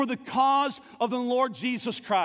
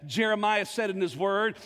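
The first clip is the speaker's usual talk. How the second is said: normal talking voice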